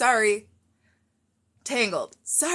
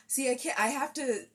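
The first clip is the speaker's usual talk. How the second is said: usual speaking voice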